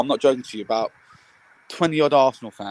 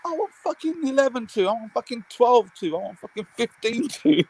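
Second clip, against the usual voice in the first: high insistent demands; wanting more goals; mock bloodthirsty rant; greedy fan; 10 semitones higher than usual. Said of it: "I'm like".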